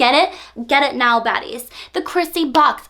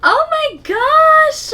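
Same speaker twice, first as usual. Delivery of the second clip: much higher, louder, drawn-out squeal; excited squeal; 14 semitones higher, 5 dB louder, much slower than usual. Real, not acted. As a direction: squeal in shocked delight. Squeals at the vibrator.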